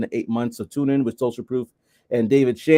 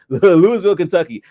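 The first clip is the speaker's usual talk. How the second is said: laughing at his own slip, louder; laughing correction; 4 semitones higher, 7 dB louder than usual. Real, not acted. Host laughing as he corrects a viewer's city, louder. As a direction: chuckle as you fix the name.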